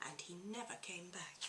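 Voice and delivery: hushed final whisper. A narrator's reading. Farmer gone for good.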